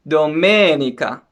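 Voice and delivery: domenica said do-ME-ni-ca; stress on second syllable. Shown as a demonstration. Teacher showing learners Sunday in Italian, domenica stressed on ME. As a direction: lean on the "me" in domenica. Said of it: "we have it on the second syllable".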